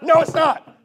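very high, very loud hammed-up denial; mock furious shout. Preacher mimics someone baited by a troll, mock furious. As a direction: yell it back, escalating a silly argument. Acted argument escalating.

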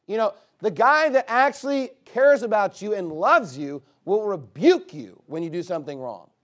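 loud, driving pulpit preaching; fiery preaching. Acted. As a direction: preach it hard to the congregation. Preaching at full force.

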